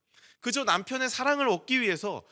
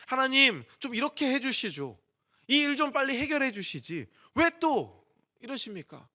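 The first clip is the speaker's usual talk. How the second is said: high, pressing complaint to God; acted-out aggrieved prayer, pitched up; hurt complaint; 5 semitones higher, 4 dB louder than usual. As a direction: plead as if let down by God. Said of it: "그런 서운한 감정이".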